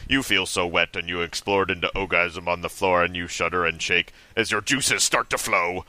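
ordinary talk, nothing marked

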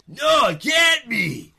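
high, slow, loud shout. Acted. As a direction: cry out in panic. Laura shouts.

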